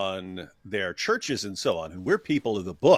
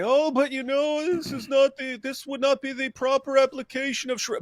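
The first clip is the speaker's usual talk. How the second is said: mock apologist; 13 semitones higher than usual. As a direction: parody the excuse in a high put-on voice. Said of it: "Muslims would say".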